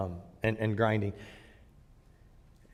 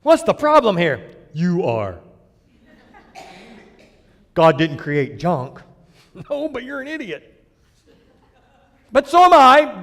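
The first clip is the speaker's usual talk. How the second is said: mock counseling exchange; 4 semitones higher, 8 dB louder than usual. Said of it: "my one-on-one counseling sounds just like that".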